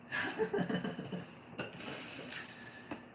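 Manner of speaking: airy, very high breathy giggle; giddy laugh. Real, not acted. Reader giddy with happiness, airy high giggle.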